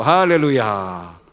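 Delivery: slow, loud, ringing 할렐루야; drawn-out hallelujah. Real, not acted. Preacher crowning his point to the congregation, slow loud hallelujah. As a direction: stretch 할렐루야 slowly and loudly.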